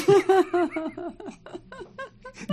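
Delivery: high burst of real laughter; big laugh. Real, not acted. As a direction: laugh hard at own punchline. 自分の話に大笑い. (高い声で大笑い)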